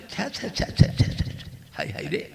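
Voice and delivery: hushed, breathy tsk of shame; ashamed tutting. Acted. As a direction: whisper the tuts, eyes covered in shame. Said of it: "মা লজ্জায়". (লজ্জায় ফিসফিস করে ছি ছি)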